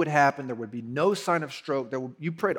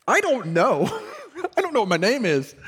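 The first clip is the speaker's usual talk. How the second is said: louder, higher, laughing at himself; laughing through it; 8 semitones higher, 4 dB louder than usual. Retold or imitated. Pastor retells forgetting his name, laughing loudly. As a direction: laugh while replaying the confusion.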